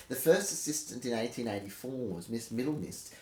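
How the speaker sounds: usual speaking voice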